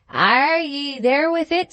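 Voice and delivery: high, slow, swooping question; surprised Scots exclamation. A narrator's reading. Alan hears of the marriage, looking back, surprised.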